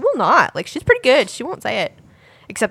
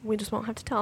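whisper; 14 dB quieter, faster than usual. Pretend. Plotting the tooth fairy secret.

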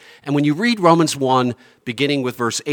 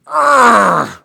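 a sudden loud high growl "Arr!"; mock growl; 11 semitones higher, 10 dB louder than usual. Shown as a demonstration. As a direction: bark out "Arr!" like a roar of wrath. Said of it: "it's like".

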